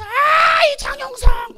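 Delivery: very high, loud screech; demon shriek. Retold or imitated. Demon recognising the preacher, horrified shriek. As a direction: imitate a demon recoiling in recognition.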